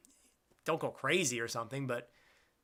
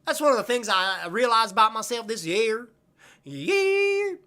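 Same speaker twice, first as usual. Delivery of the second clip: higher, louder southern twang; southern accent; 6 semitones higher, 6 dB louder than usual. Pretend. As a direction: lean into a hammed-up Southern accent. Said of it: "in a southern ac".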